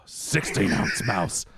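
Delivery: Al Pacino, building to a laughing shout; Al Pacino impression. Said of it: "How's your, uh, Al Pacino?".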